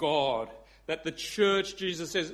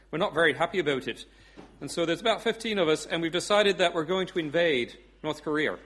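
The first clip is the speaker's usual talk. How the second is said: chatty Irish caller; Irish accent. Acted. Pub regular phoning a dictator, Irish accent. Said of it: "I do do an Irish accent".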